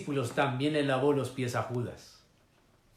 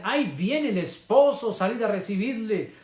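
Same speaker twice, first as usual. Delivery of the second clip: high, loud announcing call; heralding cry; 8 semitones higher, 6 dB louder than usual. Acted. Voicing the young men announcing the bridegroom to the church, heralding cry. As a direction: cry it out like youths heralding an arrival. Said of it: "la voz de los muchachos que dicen".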